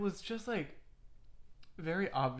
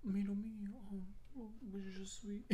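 higher, softer mock-French murmur fading to a whisper; Jacques, French accent; 5 semitones higher, 10 dB quieter than usual. Riffing on the French accent for a friend, hushed French impression. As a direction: toss out playful French-accented babble. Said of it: "remind me of the fucking shrimp from Finding Nemo".

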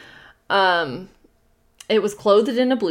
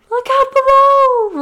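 higher, louder, slow roar; 10 dB louder, slower than usual. Acted. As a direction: roar it down the chimney. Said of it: "roared the voice".

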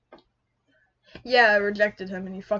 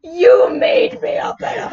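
loud, high accusation hammered home; outraged yell; 7 semitones higher, 7 dB louder than usual. Real, not acted. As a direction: shout the accusation at a friend.

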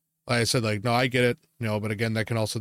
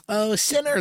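high, mocking little "Oh, sinner" from a bigot; mock judgmental jab; 11 semitones higher than usual. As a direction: sneer it like a self-righteous stranger. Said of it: "and then you go".

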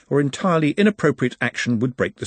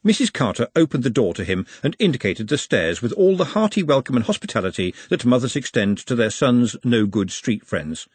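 ordinary delivery, nothing marked

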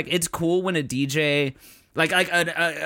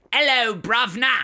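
put-on Cockney accent; 7 semitones higher than usual.

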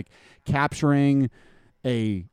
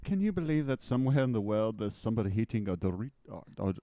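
quick, faltering British accent attempt; 8 dB quieter, faster than usual. Co-host joking about someone eating a Dorito, attempted British accent. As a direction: try a British accent and lose it. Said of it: "I can usually do a British accent".

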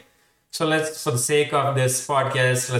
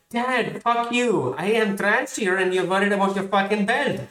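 high-pitched mock outrage; hammed-up indignant retort; indignant kid; 8 semitones higher than usual. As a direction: snap back at dad as the wronged child. Child's indignant retort to dad.

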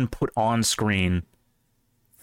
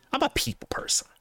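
airy, high squeaky delivery; high breathy joke voice. Pretend. Mock high breathy line.

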